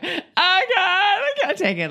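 high, wailing mock sob; hammed-up weepy cry; mock sobbing. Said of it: "I'm over here sobbing".

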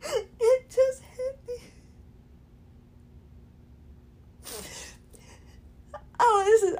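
high, tearful voice breaking into sobs; overwhelmed with relief, crying; real crying. Real, not acted. Sudden relief from pain, tears.

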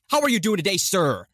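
hammed-up broadcaster small talk; Jim Rome. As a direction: order a drink as if live on air. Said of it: "that's his radio voice".

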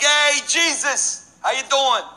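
high, drawn-out "Hey" to a buddy; boisterous barfly welcome, acted out; rowdy greeting. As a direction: hail a favorite regular across the bar. Bar crowd greets Jesus.

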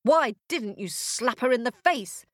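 scolding witch. Acted. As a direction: scold the cat indignantly. Witch scolds the birch tree.

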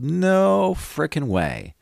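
"No frickin' way" stretched up very high and slow; dragged-out high exclamation; high emphatic no. Real, not acted. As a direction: squeal it out in disbelief. Emphatic denial.

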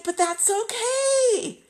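high, loud, slow reassuring cry. Retold or imitated. [name] rushing to reassure her friend, with a high loud cry. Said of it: "And I'm like".

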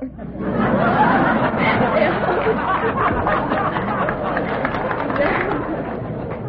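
a long high laugh, loud and rolling. Real, not acted. Operator laughing at how customers walk up a floor. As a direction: keep laughing at your own prank. Laughing at her elevator trick.

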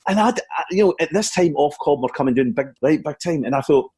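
normal talking voice

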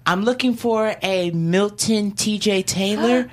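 lightly acted official calling out a name; principal summoning a student. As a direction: call the pupil out slowly and formally.

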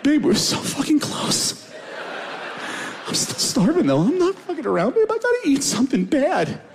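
put-on high, whiny begging to his girlfriend; whimpering. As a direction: whimper it like a starving child. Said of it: "phase two ... whimpering".

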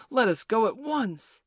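rally them to leave, loud and eager; eager cry. A narrator's reading. John seizes his hat for pirates, urging eagerly. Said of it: "cried John, seizing his Sunday hat".

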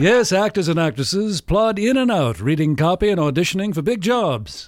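1940s mid-Atlantic announcer; mid-Atlantic accent. Pretend. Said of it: "With a mid-Atlantic accent".

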